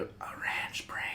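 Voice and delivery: hushed whisper. Real, not acted. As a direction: whisper it secretly. Whispered aside about snack.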